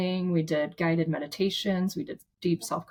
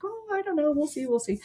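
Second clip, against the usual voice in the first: high, playful noncommittal reply; retold coy hedge; 9 semitones higher than usual. Organizer answering a guest's suggestion, retold high coy hedge. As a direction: start very high and ease down, putting them off.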